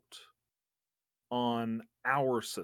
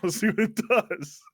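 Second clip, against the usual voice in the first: laughing, louder and higher; 10 semitones higher, 9 dB louder than usual. Real, not acted. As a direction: laugh through the words. Player laughs while declaring action.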